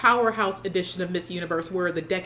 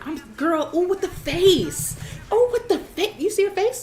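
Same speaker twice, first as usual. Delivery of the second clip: much higher, gushing squeals of delight; gushing excitement; 10 semitones higher than usual. Real, not acted. Fan gushing to viewers about a contestant's face, high excited squeal. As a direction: swoon over her face, voice jumping up high.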